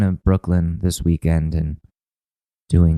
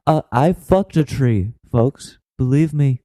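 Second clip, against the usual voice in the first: higher-pitched put-on speechifying voice; mock politician's address; 6 semitones higher than usual. Politician assuring folks about being bi, mock address. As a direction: insist to the crowd like a campaigning politician.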